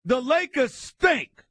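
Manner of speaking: slow, loud, high furious verdict; angry rant. Real, not acted. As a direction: spit it out slowly, disgusted. Ranting host blasts the Lakers.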